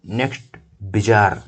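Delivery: ordinary talk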